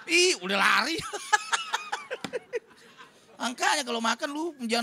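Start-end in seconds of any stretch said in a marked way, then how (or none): 1.0-2.6 squealing high-pitched laugh. Real, not acted. Preacher cracking up at his own joke, high laugh.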